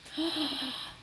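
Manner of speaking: sharp intake of breath in awe; real gasp of wonder at the present; delighted gasp. Real, not acted. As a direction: gasp as the gift appears. Unwrapping a gift.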